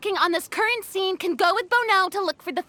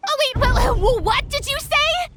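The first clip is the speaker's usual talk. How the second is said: louder, higher flustered stammer; startled and flustered, louder; shocked stammer; 5 semitones higher, 4 dB louder than usual. Acted. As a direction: caught off guard, stumbling over the words. Shocked by missing film.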